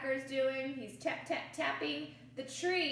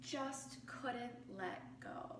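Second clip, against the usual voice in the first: soft slow whisper; 11 dB quieter, slower than usual. A narrator's reading. Reading to children, a hushed slow whisper. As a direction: breathe it out sadly. Sad end of story verse.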